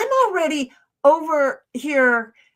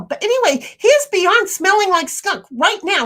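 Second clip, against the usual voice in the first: higher and louder, exasperated; complaining the dog reeks of skunk, higher and louder; exasperated emphasis; 8 semitones higher, 6 dB louder than usual. Real, not acted. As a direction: push the complaint up with disbelief.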